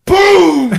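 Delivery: loud high shouted boom; explosive storytelling sound effect; imitated boom. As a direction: blast out a door-busting boom. Sound of door busted down.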